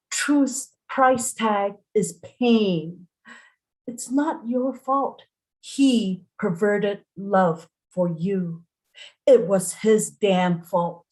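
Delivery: ordinary delivery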